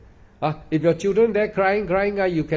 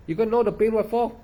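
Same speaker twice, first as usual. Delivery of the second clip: raised, scoffing retort; scoffing at the other monks' method, up high; mocking disbelief; 6 semitones higher than usual. Real, not acted. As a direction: pitch it up in scornful disbelief. Mocks the instruction he quoted.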